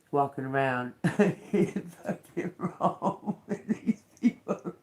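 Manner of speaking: words breaking up into laughter; laughing. Real, not acted. Telling viewers about the man in Rome, laughing. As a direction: lose it laughing while talking. Cracks up while telling the story.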